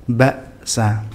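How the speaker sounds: "Baksa" cut after ba, a beat of silence, then sa; one-beat glottal stop. Shown as a demonstration. Teacher demonstrates hamzah, a clean gap splitting "Baksa". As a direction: stop the voice sharply inside "Baksa". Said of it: "jeda satu harokat".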